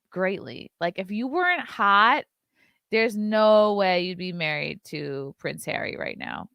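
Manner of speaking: ordinary delivery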